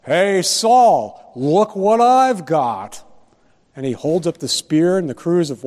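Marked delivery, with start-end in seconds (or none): 0.0-3.0 higher, louder call down to Saul, slowing at the end; David calling down to sleeping Saul, mock yell; retold yell. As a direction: call up the hill to taunt him, half acted. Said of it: "he yells down at Saul".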